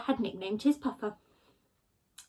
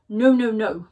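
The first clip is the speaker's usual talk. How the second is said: dismissive Badger; 8 dB louder than usual.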